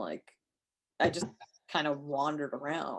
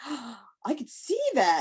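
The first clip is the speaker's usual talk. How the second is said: slow amazed drawl; 7 semitones higher, much slower than usual. Retold or imitated. People's slow reaction.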